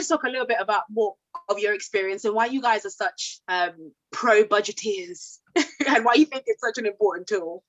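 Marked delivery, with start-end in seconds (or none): none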